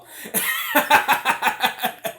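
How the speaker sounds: high burst of laughter. Real, not acted. Laughs at own joke.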